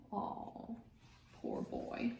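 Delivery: breathy whisper of pity; whispered sympathy. Real, not acted. Reader's aside to children.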